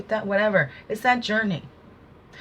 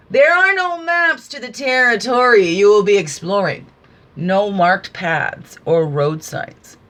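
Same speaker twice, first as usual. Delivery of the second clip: dramatic reading; 5 dB louder, slower than usual. Acted. Speaker reading a card to listeners, slow and declaiming. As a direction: read it like an oracle's proclamation.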